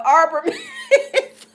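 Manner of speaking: reads it out laughing, slowly; laughing through the words; stretched out mid-laugh. Real, not acted. Reading a funny chat comment.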